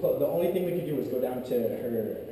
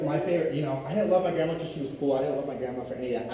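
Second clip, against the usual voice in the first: usual speaking voice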